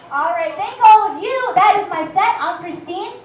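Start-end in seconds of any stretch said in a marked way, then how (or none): none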